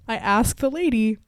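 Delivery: usual speaking voice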